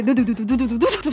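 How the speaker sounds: very fast syllables, like a patter; rapid-fire babble. Shown as a demonstration. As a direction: rattle off nonsense syllables at speed. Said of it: "말 빠른 걸로 치자면". (속사포처럼 빠르게)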